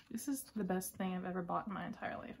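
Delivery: ordinary delivery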